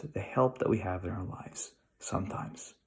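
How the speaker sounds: soft unvoiced whisper of the echoed line; hushed whisper repeating the thought. Shown as a demonstration. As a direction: breathe it like a confided secret.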